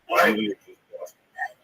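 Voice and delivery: usual speaking voice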